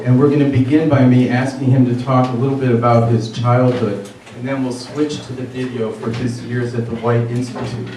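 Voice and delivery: ordinary talk, nothing marked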